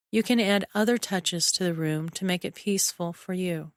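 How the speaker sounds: ordinary talk, nothing marked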